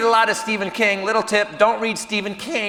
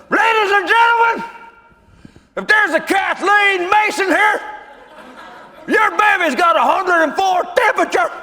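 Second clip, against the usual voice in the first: Boss Hog on the mic; 11 semitones higher, 3 dB louder than usual. Retold or imitated. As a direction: bellow it out to the whole room like a big southern club owner. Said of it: "turns back and goes".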